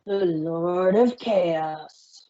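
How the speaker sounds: slow, drawn-out herald call; grand announcement. Acted. Spike introducing Discord to the court, slow and grand. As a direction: announce him with great pomp. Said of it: "clears throat".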